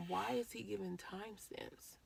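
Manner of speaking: breathy worried whisper. Retold or imitated. Telling her partner how she feared the news, whispering. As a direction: hush it, dreading the answer. Retold inner question.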